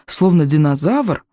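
ordinary delivery

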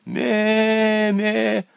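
high, thin little "ме" from below; a tiny kid's weak bleat; faint bleat. A narrator's reading. Hidden kid under the porch, faintly bleating. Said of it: "послышалось слабое".

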